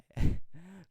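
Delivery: loud high real laugh; burst of laughter. Real, not acted. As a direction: break out laughing at the title. Laughs at the video title.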